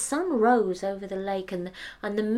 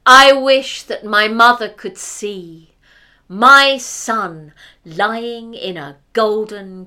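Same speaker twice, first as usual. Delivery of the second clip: slow and much louder; grand proclamation; 18 dB louder, slower than usual. Acted. The hunter declares his one wish, loud and slow. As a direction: announce the wish solemnly.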